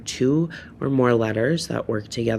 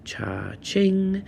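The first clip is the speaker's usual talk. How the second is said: cha-ching; 8 semitones higher than usual. Acted. Teacher cheers a sound effect.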